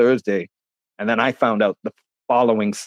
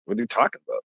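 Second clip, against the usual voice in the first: stunned disbelief; 9 semitones higher, 6 dB quieter, faster than usual. Retold or imitated. Shocked by news of friend's death.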